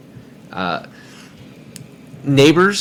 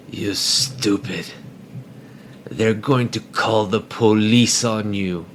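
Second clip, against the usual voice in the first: dad scolding; 4 dB quieter, slower than usual. Retold or imitated. Dad scolding son in street.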